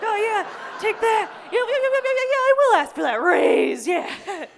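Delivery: loud, high pumped-up yeahs; hammed-up triumphant chanting; mock self-hype. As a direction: hype yourself up at the mirror.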